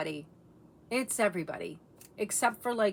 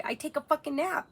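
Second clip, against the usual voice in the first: put-on little girl; 7 semitones higher than usual. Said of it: "Well, what else did you say?".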